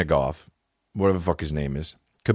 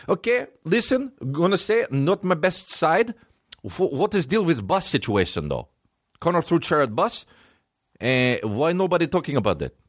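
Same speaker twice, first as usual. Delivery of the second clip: much higher, hammed Russian accent; a mock apology, pitched way up; 8 semitones higher than usual. Khabib deflects at the press conference, hammed Russian accent. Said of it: "worst apology of all time".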